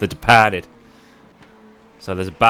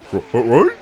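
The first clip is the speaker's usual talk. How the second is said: Scooby-Doo impression; 4 semitones higher, 5 dB louder than usual. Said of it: "like Scooby-Doo going".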